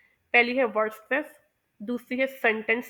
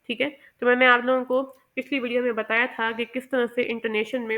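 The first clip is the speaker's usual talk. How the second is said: normal talking voice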